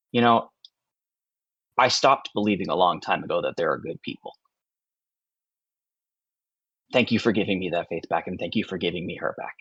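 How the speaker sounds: subdued, heartfelt gratitude; quietly grateful father. Acted. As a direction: thank them softly, pausing to find the words. Grateful father after silent hug.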